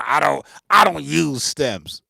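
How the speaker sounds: loud, slow sneering imitation; mock denial. Producer mocks people denying stems, loud slow mockery. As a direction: parody a stubborn producer. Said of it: "People be saying".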